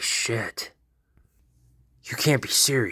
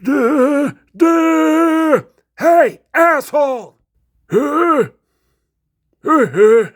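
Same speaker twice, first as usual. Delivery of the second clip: much higher, loud, dopey grunting; dumb brute voice; 13 dB louder, much slower than usual. Acted. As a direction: grunt like a dim-witted monster. Leatherface grunting in a silly voice.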